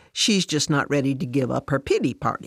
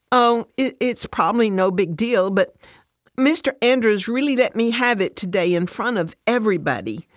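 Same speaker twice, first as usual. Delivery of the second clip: upset, higher-pitched recounting; holding back tears; 5 semitones higher, 3 dB louder than usual. A narrator's reading. Fran telling Alice about her meeting, upset. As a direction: pour out the hurt, keeping tears back. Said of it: "still fighting to hold back her tears".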